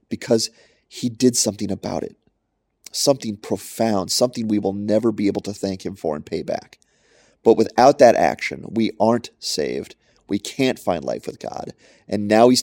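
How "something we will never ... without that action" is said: usual speaking voice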